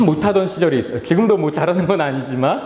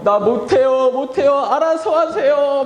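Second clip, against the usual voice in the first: higher, whiny mock helplessness; imitated weary refusal; 6 semitones higher than usual. Pastor acting his weak past self refusing family, higher whiny plea. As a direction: beg off like someone with no strength. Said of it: "힘이 없으니까".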